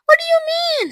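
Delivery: high bewildered question; ask, confused; puzzled. Acted. Violet puzzled.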